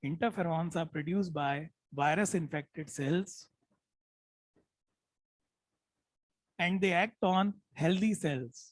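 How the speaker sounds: drawn-out dictation pace, each word separate; slow dictation. Shown as a demonstration. Teacher repeating the point for students to write, slow. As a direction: dictate slowly so they can keep up. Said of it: "मैं जानबूझ के धीरे बोल रहा हूँ".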